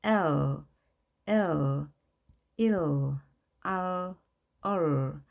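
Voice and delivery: "L, 일, 얼" each gliding through an "uh" into a dark final L; drawn-out syllables "L, 일, 얼" melting into dark L; English dark L. Shown as a demonstration. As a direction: let "L" and "얼" end on an English L, unlike Korean rieul. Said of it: "우리 한글의 리을과는 다른 어 발음이었죠".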